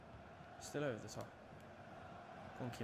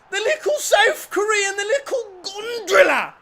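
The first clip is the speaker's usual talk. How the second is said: very high, very loud yell cracking with emotion; tearful ecstatic scream; 20 dB louder than usual. Real, not acted. Fan screaming Kimmy's name after the equaliser, very high, loud and teary. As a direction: scream the scorer's name half in tears.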